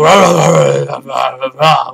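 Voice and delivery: loud gargled growl through teeth; Batman growl. Shown as a demonstration. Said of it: "And just go".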